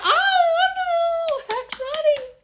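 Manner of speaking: very high, sing-song and gushing; mock sweet falsetto. To her fans reading comments, a high cooing diva voice. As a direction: coo the praise way up high like a pleased diva.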